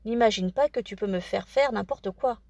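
ordinary delivery, nothing marked

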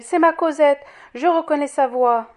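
a mother's ecstatic cry; overjoyed recognition. A narrator's reading. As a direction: call it out with sheer delight. Fantine believes she hears her daughter.

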